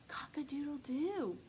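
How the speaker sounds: a tiny breathless whisper of a crow. Acted. Rooster losing his voice, whispered crow. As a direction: rooster trying to crow but only whispers.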